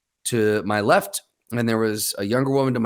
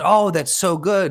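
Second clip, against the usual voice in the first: drawn-out, higher imitation of delight; delighted older woman; 6 semitones higher, 3 dB louder than usual. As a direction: mimic savoring a sip, slow and high. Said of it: "took a drink and she goes".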